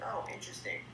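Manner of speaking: breathy whisper, as if thinking it; inner thought whisper. Retold or imitated. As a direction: whisper it to yourself, intrigued. Customer's inner thought, hushed.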